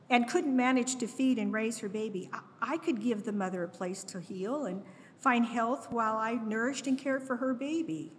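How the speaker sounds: usual speaking voice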